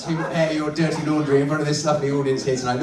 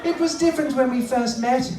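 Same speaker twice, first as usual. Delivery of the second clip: high wistful wife puppet; put-on high female character; feminine voice; 10 semitones higher than usual. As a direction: reminisce as the lady dummy. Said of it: "let's find a nice feminine voice".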